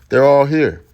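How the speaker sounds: loud, high emphatic cry; emphatic shout. Real, not acted. Preacher to congregation, loudly stressing everyone is here. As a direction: drive it home to the congregation. Preacher hammering his point home.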